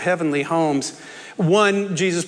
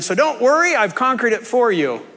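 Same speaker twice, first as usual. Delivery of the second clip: higher, emphatic victor's promise; triumphant reassurance; 5 semitones higher than usual. Shown as a demonstration. Preacher voicing the mistaken reading, emphatic triumphant reassurance. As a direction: declare the victory as already won for them. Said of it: "Because it is not".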